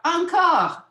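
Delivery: high, loud French cheer; excited storybook shout. Acted. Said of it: "they shout".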